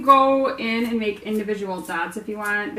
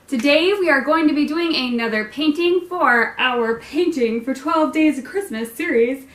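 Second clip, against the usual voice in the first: higher, louder announcer read; broadcaster voice; 5 semitones higher, 5 dB louder than usual. Shown as a demonstration. Host announcing the series in broadcaster voice. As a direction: sell it like a TV presenter. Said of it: "broadcaster voice".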